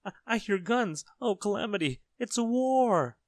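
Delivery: high, loud alarm, stretching the final word; panicked cry. A narrator's reading. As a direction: wail in mounting terror, very high. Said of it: "cried Mister Jelly".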